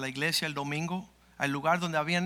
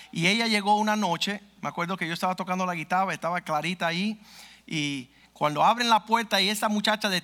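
raised, louder preaching voice; fervent preaching; 2 semitones higher, 3 dB louder than usual. Acted. Pastor recounting the possessed woman to the congregation, fervent. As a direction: drive the story home to the church. Preacher telling the deliverance story.

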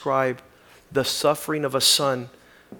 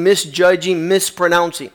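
louder preaching, higher than his opening talk; 6 semitones higher, 10 dB louder than usual. Acted. Preacher turns from the letter to the congregation, preaching. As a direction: drive it home to the congregation.

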